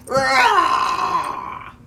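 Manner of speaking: loud, high-pitched roar; lion's roar. A narrator's reading. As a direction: burst out roaring to scare. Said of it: "the lion jumped up".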